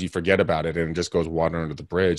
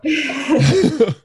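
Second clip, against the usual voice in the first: high laugh; 6 dB louder than usual. Real, not acted. Laughing at own plot spoiler.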